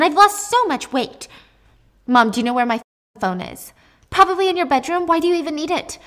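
high, loud, fretting mom voice; fussy mother. Acted. Mother scolding her daughter after prison, high and fretting.

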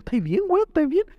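imitating a friend gone high and jittery with panic; nervous friend. Friend asking the bleeding narrator if he's okay, nervous high voice. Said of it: "empezó a reírse así como terrible nervioso".

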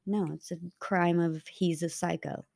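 usual speaking voice

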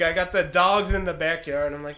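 ordinary talk

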